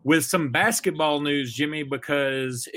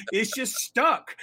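quoting Columbus; 8 semitones higher, slower than usual. Retold or imitated. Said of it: "And he said".

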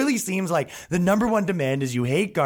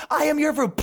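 high self-righteous mock voice; earnest activist; 12 semitones higher than usual. Activist refusing to shut up, mock righteous voice. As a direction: sound proudly principled.

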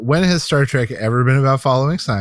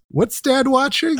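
put-on high, slow little kid voice; childlike curious question; quoting a kid; 13 semitones higher, slower than usual. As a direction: lift the pitch and ask it slowly like a curious child. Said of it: "It says".

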